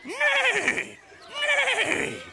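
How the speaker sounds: very high whinnying neigh; whinny like a horse. A narrator's reading. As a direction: horses calling to the farmer. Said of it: "said the horses".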